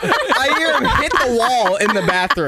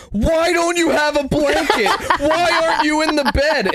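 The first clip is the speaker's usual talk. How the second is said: imitated yelling; 9 semitones higher than usual. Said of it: "just yelling at Mason".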